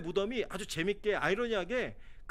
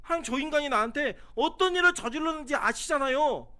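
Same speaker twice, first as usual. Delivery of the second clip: bitter complaint; 6 semitones higher, 5 dB louder than usual. Retold or imitated. Imitating bitter complaint to God.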